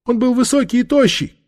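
very high crone voice; frightened old woman. Acted. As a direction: answer the interrogator in a high whine. Old woman answers interrogator.

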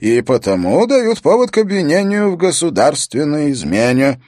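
loud, insistent courtly pronouncement; formal declaration. A narrator's reading. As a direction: state the law formally before the court. Chamberlain formally declares treason to king.

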